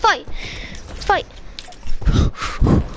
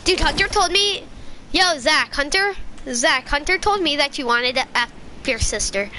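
ordinary talk, nothing marked

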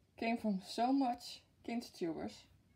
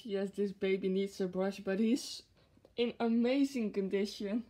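ordinary talk

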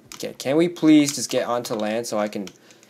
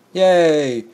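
loud, higher little cheer; 6 semitones higher, 8 dB louder than usual. Real, not acted. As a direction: let out a cheer. Cheering in the game.

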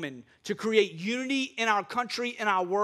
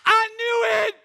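high, loud gleeful shout; hammed-up cry of vindication; mock triumph; 12 semitones higher, 8 dB louder, slower than usual. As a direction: burst out as a vindicated libertarian. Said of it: "All the libertarians are like".

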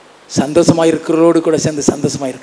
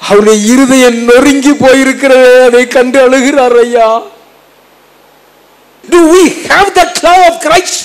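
fervent preaching; 9 semitones higher, 7 dB louder than usual. Acted. Preacher describing Jesus weeping to the church, high and loud. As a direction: climb into a fervent plea.

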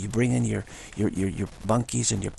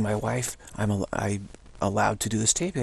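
ordinary talk

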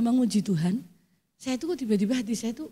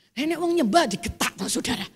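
ordinary talk, nothing marked

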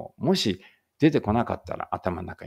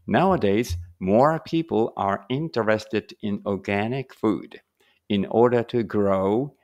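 slow, careful English reading for learners; slow read-aloud; much slower than usual. Shown as a demonstration. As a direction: read each word out slowly and clearly. Reads English passage slowly. (ゆっくり丁寧な英語の音読)

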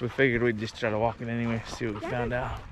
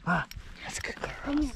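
high dog praise; 12 semitones higher, 9 dB quieter than usual. Real, not acted. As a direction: coo it to the dog, softly and high.

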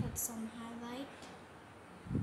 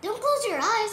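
loud command; 6 semitones higher, 12 dB louder than usual. Real, not acted. Loud laughing instruction.

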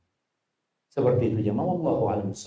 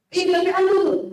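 very high, louder needling jab; insistent mocking; 5 dB louder than usual. Imagined mocker presses the insult, very high and louder. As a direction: push the insult harder, shrill and teasing. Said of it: "Kira-kira dia akan jawab apa".

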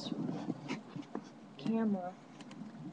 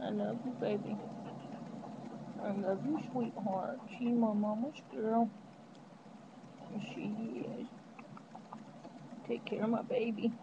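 tender cooing; 7 dB quieter than usual. Real, not acted. Tender to the dog.

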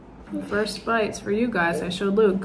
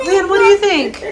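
cheering, louder and higher; excited mom cheering; 8 semitones higher, 8 dB louder than usual. Real, not acted.

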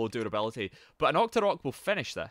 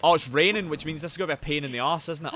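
usual speaking voice

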